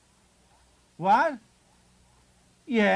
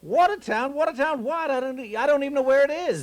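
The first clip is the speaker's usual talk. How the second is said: high, bewildered disbelief; baffled; 7 semitones higher than usual. Acted. Husband baffled by the charge, high bewildered disbelief. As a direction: repeat the name as if it's nonsense.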